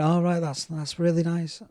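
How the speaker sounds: retold lukewarm approval, quiet and noncommittal; unimpressed. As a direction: imitate someone politely underwhelmed. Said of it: "he wasn't impressed".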